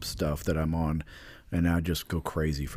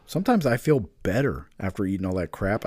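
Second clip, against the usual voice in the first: ordinary talk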